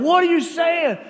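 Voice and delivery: high, loud, puzzled shout; oblivious friend yelling his question. Retold or imitated. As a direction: blurt it across the room. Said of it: "he just, like, yells out".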